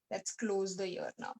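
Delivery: ordinary talk, nothing marked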